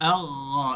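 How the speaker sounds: আল্লাহ with the last হ held out in a breath; আল্লাহ ending in a long breathy হ; held final ha. Shown as a demonstration. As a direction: let the হ at the end of আল্লাহ linger. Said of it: "এই যে ধরে রাখাটা".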